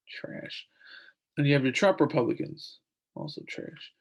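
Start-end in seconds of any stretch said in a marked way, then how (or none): 0.1-0.7 unvoiced, dismissive whisper under the breath; whispered aside. Real, not acted. Vlogger dismissing McConnell Republicans in a whispered aside. 3.1-3.9 unvoiced, dismissive whisper under the breath; whispered aside. Real, not acted. Vlogger dismissing McConnell Republicans in a whispered aside.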